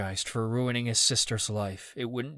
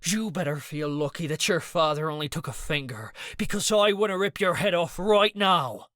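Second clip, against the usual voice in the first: rising into a loud angry threat; 7 semitones higher, 4 dB louder than usual. A narrator's reading. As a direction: build the fury until it bursts. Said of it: "Danny growled".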